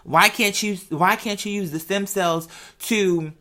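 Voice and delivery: normal talking voice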